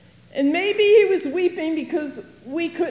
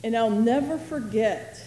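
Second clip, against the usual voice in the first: ordinary talk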